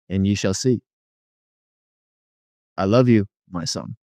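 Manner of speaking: a measured blessing, dropping low on the last words; God the Father. Retold or imitated. The Father telling his son he loves him, gently solemn. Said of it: "And he says".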